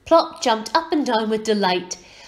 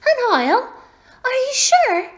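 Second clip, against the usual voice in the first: put-on very high, loud child's voice; doubting little girl; 6 dB louder than usual. A narrator's reading. Little girl questions the woolly ball, high and doubtful. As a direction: squeak it as an incredulous question.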